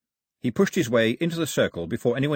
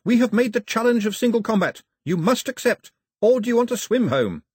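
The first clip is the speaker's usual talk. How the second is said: high, loud challenge; yell; 12 semitones higher, 6 dB louder than usual. A narrator's reading. Pilou challenges the raiders to single combat, high yelling. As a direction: shout the threat at full pitch. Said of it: "Pilou yelled in the confusion".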